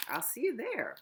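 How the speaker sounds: usual speaking voice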